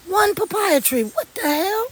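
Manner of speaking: high, stunned disbelief; can't believe one tree does this; amazed. Real, not acted. To viewers, stunned by one papaya tree.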